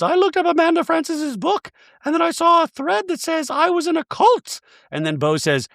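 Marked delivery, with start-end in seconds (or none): 0.0-4.6 high, prim voice for Dorit; Dorit impression. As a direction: play Dorit sweetly apologetic in a high voice. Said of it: "another scene where Dorit says".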